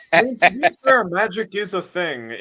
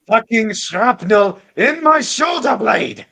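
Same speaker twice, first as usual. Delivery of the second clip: loud, high, slow furious yell; a veteran shouting about his old war wound; angry shout; 8 semitones higher, 7 dB louder, slower than usual. Acted. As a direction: blast the accusation at her.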